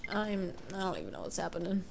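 usual speaking voice

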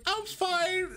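very high mock-brave reply; retold squeaky reassurance. Retold reply after falling.

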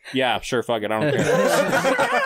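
laughing shrug. Real, not acted. Shrugging agreement to war.

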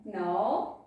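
normal talking voice

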